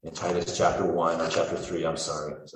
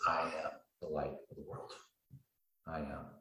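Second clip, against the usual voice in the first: hushed quote; 11 dB quieter than usual. Acted. Jesus quoted in hushed awe.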